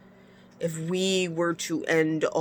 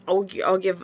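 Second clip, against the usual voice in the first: normal talking voice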